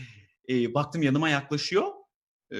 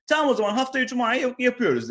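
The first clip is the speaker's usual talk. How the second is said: higher, louder chirpy boss voice; mock manager; 9 semitones higher, 5 dB louder than usual. Comedian mimics manager setting a deadline. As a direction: wrap it up sweetly.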